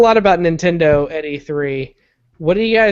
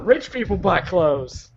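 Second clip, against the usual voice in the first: mock snooty; slower than usual.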